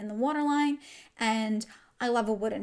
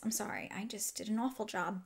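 embarrassed whisper; 8 dB quieter than usual. Real, not acted. Embarrassed aside about bad smudging.

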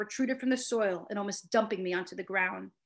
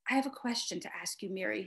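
softer, hushed confiding tone; lowered voice; 3 semitones lower, 7 dB quieter than usual. Acted. Kenneth confiding a secret to Mary, hushed. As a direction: drop the voice so no one overhears. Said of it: "lowering his voice".

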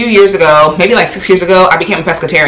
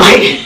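breathy laugh. Real, not acted. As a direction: laugh that fades into a silent giggle. Laughing at diaper idea.

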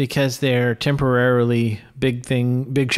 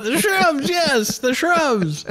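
very high, drawn-out movie quote; hammed-up agreement, pitched sky-high and slowing; mock bandito echo; 12 semitones higher, slower than usual. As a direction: play along as the excited bandit sidekick. Co-host joins in quoting bandit scene.

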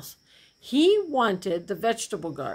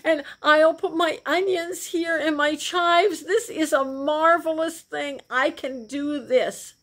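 higher, gleeful planning of his garden; excited; 8 semitones higher, 3 dB louder than usual. Acted. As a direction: gloat happily over the new land. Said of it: "since he was so excited".

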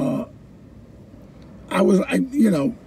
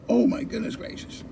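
stunned whisper; 3 semitones higher, 9 dB quieter than usual. Retold or imitated. Teacher stunned by poet's shout.

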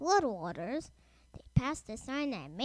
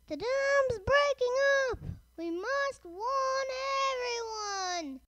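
high, drawn-out shout of warning; shouted alarm; 10 semitones higher than usual. A narrator's reading. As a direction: call out the warning in a high, stretched voice. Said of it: "shouted Toby's driver".

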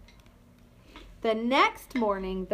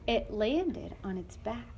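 ordinary delivery, nothing marked

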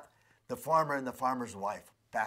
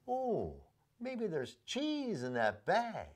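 hopeful mouse; 6 semitones higher, slower than usual. Acted. Mouse wishing about cheese.

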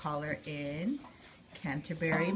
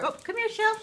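much higher coaxing dog call; high pet-talk call. Real, not acted. Calling the other dog over.

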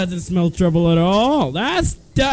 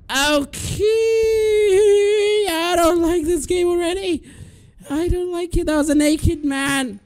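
shaken high whine; 9 semitones higher than usual. Real, not acted. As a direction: whine up high, rattled by the scare. Shaken after the jump scare.

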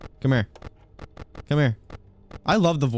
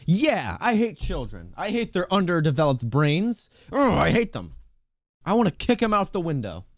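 higher, exaggerated loathing; mock rant; 4 semitones higher than usual. Streamer joking about hating children, mock loathing. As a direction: rant in a higher voice, playfully hateful.

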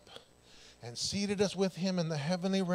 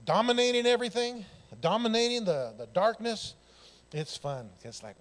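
ordinary talk, nothing marked

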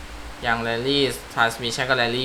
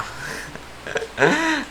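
high giggle; 13 semitones higher, 6 dB quieter than usual. Real, not acted. Unsure which track to pick.

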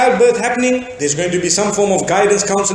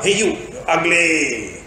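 slow, lower cheeky parrot call; rude parrot; 4 semitones lower, 4 dB quieter, slower than usual. Retold or imitated. Parrot taunting the woman again, slow mock bird voice. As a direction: taunt her like a mischievous bird. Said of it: "So the parrot picks it up".

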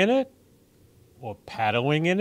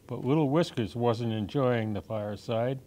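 ordinary delivery

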